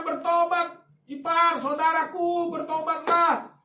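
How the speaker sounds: slow, drawn-out urging to repent; a preacher's earnest slowed appeal; slow pleading call. Retold or imitated. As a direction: lean in and plead, word by word. Preacher urging sick in-law.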